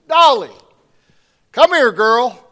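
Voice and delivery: loud, high call across the yard; calling the dog. Acted. Farmer calling his dog out of the doghouse, loud and high.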